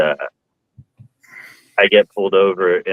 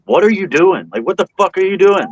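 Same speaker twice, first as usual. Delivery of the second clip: put-on high, fast scolding yell as the older cop; the senior cop chewing out his rookie; 11 semitones higher, 3 dB louder than usual. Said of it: "laying into her".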